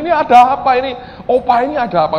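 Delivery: high, loud, alarmed questioning; retold bewilderment. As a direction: act out baffled panic at full voice. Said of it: "saling lihat-lihatan bingung".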